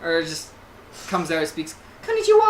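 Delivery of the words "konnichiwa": very high, loud anime-style greeting; squeaky anime character voice; Japanese greeting. Pretend. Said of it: "Full Japanese".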